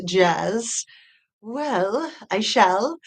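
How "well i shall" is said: put-on botched Southern accent that sounds vaguely British; a bad Southern accent, lilting up at the ends; attempted Southern accent. Streamer answering a viewer's accent request, attempted Southern accent. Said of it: "British or something".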